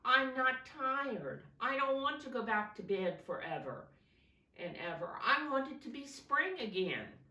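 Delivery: loud, high whining plea; whiny protest. Acted. Bear protests to tortoise about bedtime, whining loudly. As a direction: whine like a child resisting bed.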